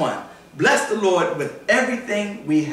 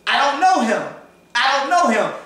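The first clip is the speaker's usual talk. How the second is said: Peter's denial; 9 semitones higher, 4 dB louder than usual. Retold or imitated. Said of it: "'Cause when you said".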